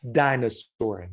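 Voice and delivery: Dinosaur said slowly, syllable by syllable; slow answer. Shown as a demonstration. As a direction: draw out Dinosaur as the class answer. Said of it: "what is it, everybody?".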